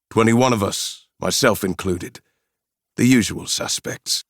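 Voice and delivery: matter-of-fact South African mercenary; South African accent. Acted. Mercenary reports his troop numbers, South African accent. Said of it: "With a strong South African accent".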